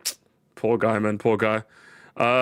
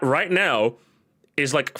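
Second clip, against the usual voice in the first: ordinary talk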